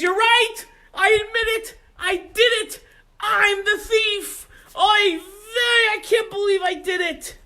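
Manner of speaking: loud, high, crying out; the thief confessing to his rebbe; frantic confession. Acted. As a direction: blurt it all out at once.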